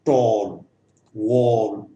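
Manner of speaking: टॉल, वॉल with a drawn-out rounded aw; low, falling long-vowel examples; long vowel demo. Shown as a demonstration. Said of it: "long sound हो गया।".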